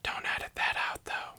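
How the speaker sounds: quick hushed whisper; fast secret aside. Pretend. Whispered joke continues.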